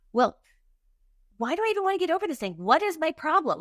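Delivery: high-pitched, frustrated self-questioning. Retold or imitated. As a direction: replay your exasperation, pitch shooting up. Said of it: "I was getting so frustrated".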